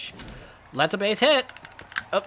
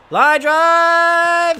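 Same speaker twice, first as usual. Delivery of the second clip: excited call; 11 semitones higher, 9 dB louder than usual. Real, not acted. Calling a hit.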